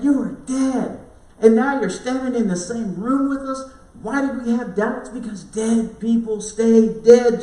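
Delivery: agitated disciples. Retold or imitated. Disciples voiced, agitated and afraid.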